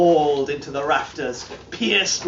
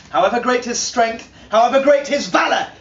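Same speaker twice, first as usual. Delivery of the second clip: higher and louder, building the line; rising declamation; 8 semitones higher, 5 dB louder, faster than usual. Acted. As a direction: swell up on each great. Building climax of saga.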